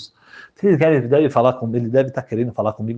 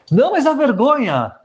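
begging groom; 13 semitones higher, 3 dB louder than usual. Acted. Exposed groom begging the rabbi, high and pleading. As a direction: beg him not to expose you, voice pitched way up.

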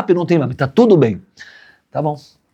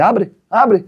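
urgent; 10 semitones higher than usual. Retold or imitated. Urging wife to open door.

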